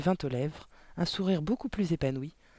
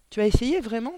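higher, louder question of surprise; astonished; 7 semitones higher, 7 dB louder than usual. A narrator's reading. Miss Candy to Mathilda about her quatrains, astonished. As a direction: ask it in amazement, pitch lifted. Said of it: "s'étonna Mathi-, mademoiselle Candy, plus éberluée que jamais".

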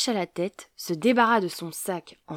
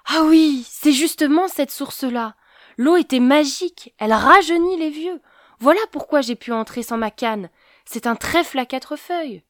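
higher, louder eureka; excited realisation; 7 semitones higher, 8 dB louder than usual. Acted. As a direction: burst out with the discovery. Old man suddenly understands the magic.

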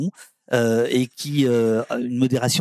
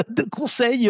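half laugh it out, amused at himself; 10 semitones higher, slower than usual. Real, not acted. Guest explaining the chat's role, laughing through the word. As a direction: repeat the word through a real laugh, pitch jumping up.

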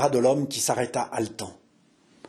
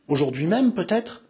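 timid, hopeful question lifting high at the end; 4 semitones higher than usual. A narrator's reading. Jeanne asks Henri whether François will soon return, timidly. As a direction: ask it timidly, voice rising. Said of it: "dit-elle timidement".

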